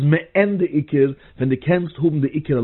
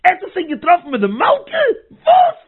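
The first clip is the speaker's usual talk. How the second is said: high, loud shocked cry of disbelief; an astonished yell, much higher and louder; shocked scream; 6 dB louder than usual. Retold or imitated. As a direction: shriek it out in stunned surprise. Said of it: "ער וועט געבן א שריי ארויס".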